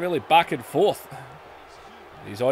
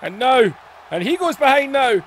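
excited commentator call; 12 semitones higher, 3 dB louder than usual. Real, not acted. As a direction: build up to the strike and yell it. Big move called in play-by-play.

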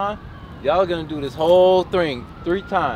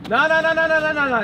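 high rattled-off string of refusals; head them off before they quit; insistent high-pitched protest; objecting; 8 semitones higher than usual. Acted. Dad stops kids quitting.

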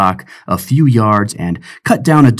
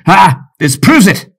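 higher, louder gleeful cry; gleeful triumph; 9 semitones higher, 5 dB louder than usual. Acted. Pirate James crowing over the letter, gleeful triumph. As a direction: crow it out.

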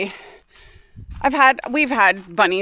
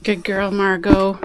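usual speaking voice

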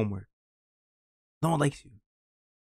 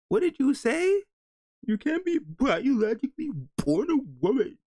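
very high, incredulous squeal; shrieked disbelief; 13 semitones higher than usual. Real, not acted. Reacting to what she said, high incredulous shriek.

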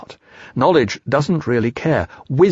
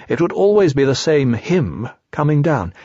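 ordinary delivery

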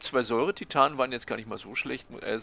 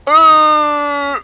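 being chased; 11 semitones higher, 13 dB louder, slower than usual. Real, not acted. Swarmed by enemies in game.

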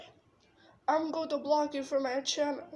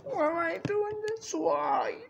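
tearful wail; 2 semitones higher than usual. Real, not acted. Wailing amid sobs.

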